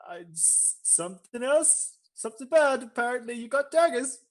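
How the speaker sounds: put-on high, hesitant German accent. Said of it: "The German sound individual".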